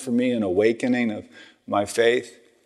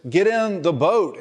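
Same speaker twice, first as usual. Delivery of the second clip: high insistent call; 9 semitones higher than usual. Retold or imitated. Boatman urging woman to climb in, high calling out. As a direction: call her into the boat, pitched up. Man in boat calls to woman.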